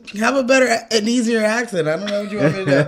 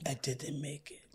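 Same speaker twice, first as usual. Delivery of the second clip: whispered, much softer; mock whisper; 5 semitones lower, 22 dB quieter than usual. Quoting Arnold missing the class, whispered. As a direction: breathe it out defeated. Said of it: "when Howard misses Jamie's class".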